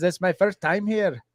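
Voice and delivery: put-on muddle Irish and Spanish accents together; playful mash-up of Irish and Spanish accents. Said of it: "Ireland went to Spanish".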